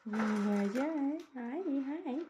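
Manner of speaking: loud, delighted greeting rising high; excited delight. Real, not acted. Owner greets bird that came down, excited.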